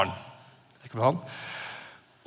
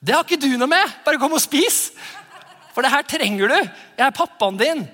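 dad answering; 7 semitones higher than usual. Retold or imitated. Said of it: "jeg sier".

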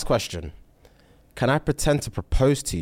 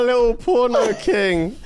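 mock teasing; 11 semitones higher, 5 dB louder than usual. Real, not acted. Teasing echo of the host.

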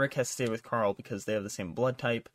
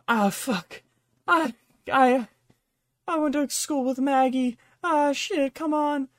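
much higher, loud panicked whine; panicked kid; 14 semitones higher, 8 dB louder, slower than usual. Retold or imitated. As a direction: squeal it out like a terrified prisoner begging. Captured kid pleading in panic.

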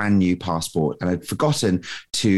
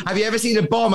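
laughing quote; 10 semitones higher, faster than usual. Retold or imitated. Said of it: "she's like".

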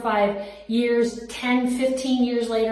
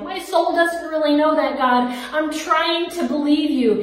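higher and louder, pushing back at God; pleading protest; 6 semitones higher, 4 dB louder than usual. Retold or imitated. A woman tells God she can't believe him yet, raised pleading protest. Arguing honestly with God in prayer.

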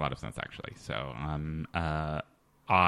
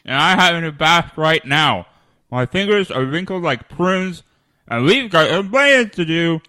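higher, louder, talking through a mouthful of water; 10 semitones higher, 10 dB louder than usual. Acted. Host reads closing tagline to listeners, gargling through water. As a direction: read the tagline with your mouth full of water. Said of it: "have the water in your mouth".

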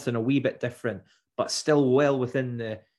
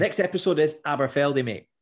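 Aussie accent, bolder; 3 semitones higher, 3 dB louder than usual. Shown as a demonstration. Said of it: "Read the rest of it".

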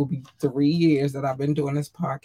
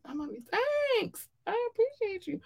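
squeaky cute voice; 7 dB quieter than usual. Real, not acted. Cute thanks to fans.

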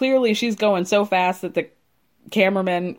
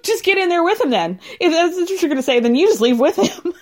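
laughing retort; 7 semitones higher than usual. Retold or imitated. Mocking retold retort, laughing.

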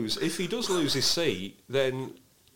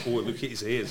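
ordinary delivery

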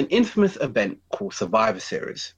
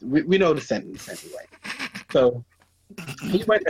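stifled giggles; 5 semitones higher than usual. Real, not acted. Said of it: "I'm trying not to laugh".

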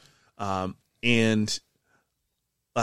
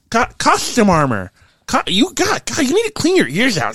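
high-pitched, indignant craftsman; exasperated correction; 13 semitones higher, 7 dB louder than usual. Acted. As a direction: scold them for not listening, voice pitched up. Character exasperated at being misheard.

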